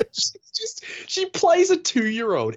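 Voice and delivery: mocking disdain. Real, not acted. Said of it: "angry bully".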